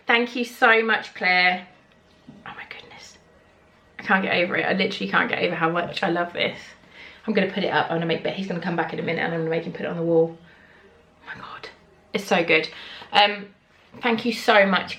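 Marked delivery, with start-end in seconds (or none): 2.5-3.2 soft whispered wonder; awed whisper. Real, not acted. Whispered awe. 11.2-11.7 soft whispered wonder; awed whisper. Real, not acted. Whispered awe.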